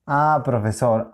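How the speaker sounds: normal talking voice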